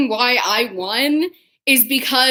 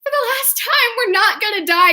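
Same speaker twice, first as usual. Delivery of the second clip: high exasperated mock outburst, coming down; exasperated retort; 10 semitones higher, faster than usual. Friend tells her they won't die, exasperated outburst. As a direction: insist it at the end of your patience.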